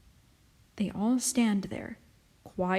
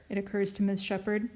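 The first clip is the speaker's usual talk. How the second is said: plain reading voice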